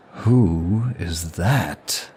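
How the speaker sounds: slow, drawn-out intrigue; captivated. Acted. Demon spots a gorgeous human at the ball, captivated. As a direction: stretch the words as someone catches your eye. Suddenly spots an enticing stranger.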